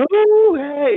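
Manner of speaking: high, drawn-out mock whoop; long, high teasing "uh"; imitating a cheery riser. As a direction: mimic someone waking up in a great mood. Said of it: "Fernando está como".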